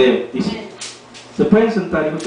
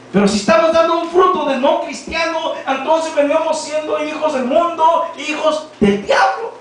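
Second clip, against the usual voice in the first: preaching warning; 5 semitones higher than usual. Acted. Preacher warns congregation.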